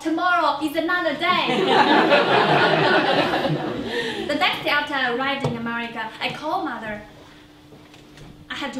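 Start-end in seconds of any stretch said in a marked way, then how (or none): 1.5-4.2 hearty laugh, higher and louder. Real, not acted. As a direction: let a big laugh burst out. Laughter after the joke.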